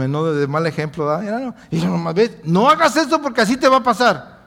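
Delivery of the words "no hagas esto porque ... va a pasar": quick, raised warning voice; mock warning. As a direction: wag a finger at the listener, fast. Predicador imita advertencia. (advertencia burlona, rápida y aguda)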